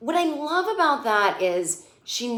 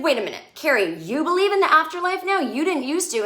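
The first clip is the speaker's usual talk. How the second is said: indignant Miranda; 8 semitones higher, 4 dB louder than usual. Retold or imitated. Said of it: "Miranda's like".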